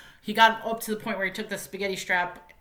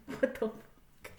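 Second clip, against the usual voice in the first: slow breathy what the fuck; stunned whisper; 9 dB quieter, slower than usual. Real, not acted. Reviewer stunned by the ending, slow whispered disbelief. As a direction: let it out barely voiced. I'm like.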